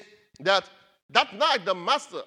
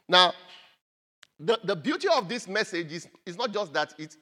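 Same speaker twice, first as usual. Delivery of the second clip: ordinary delivery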